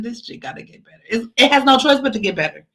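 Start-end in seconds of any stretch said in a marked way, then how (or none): 0.0-1.0 quick, whispery mock-tearful mutter; hushed imitation of crying through it; imitated crying. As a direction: act tearful under your breath. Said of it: "I could be crying like".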